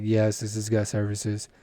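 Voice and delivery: low, slow, unenthused phone answer; imitated store clerk. Acts out own clerk answer.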